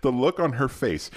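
laughing. Real, not acted. Laughing while retelling the story.

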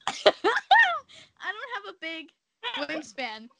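laughing through "I don't have a big", drawn out slowly; laughing, slowed. Real, not acted. Telling friends about her short arms, laughing slowly. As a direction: stretch "I don't have a big" out while cracking up.

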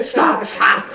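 high, breathy stop stop through laughter; laughing mock plea. Retelling the bystander at the range, laughing through a mock plea. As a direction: beg them to stop while cracking up. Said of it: "the guy behind him's like".

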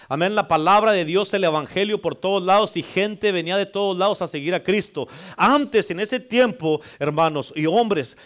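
full-force preaching. Acted. Pastor rousing his congregation about Jesus, fervent loud preaching. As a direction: preach it out to the whole church, building fervour.

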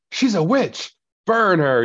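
high-pitched witch-hunt accusation; mock mob cry.

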